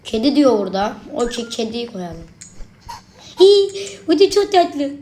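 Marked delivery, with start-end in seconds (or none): 3.4-4.9 high cooing squeal; delighted squeal. Real, not acted. Gamer coos at the cute plush, high squeal. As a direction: squeal over something cute.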